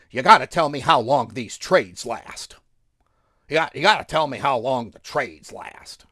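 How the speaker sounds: mock demanding viewer.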